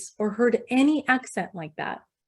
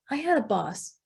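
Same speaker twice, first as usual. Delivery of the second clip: ordinary delivery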